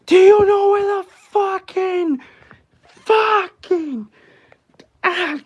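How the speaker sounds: over-the-top New York accent screaming. Shown as a demonstration. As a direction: yell it like an angry New York cabbie. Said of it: "that's essentially just a New York accent right there".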